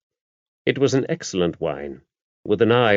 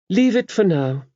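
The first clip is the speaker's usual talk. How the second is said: a sharp, higher command from the abbess; 12 semitones higher than usual. A narrator's reading. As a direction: cut her off sharply. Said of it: "said the abbess sharply".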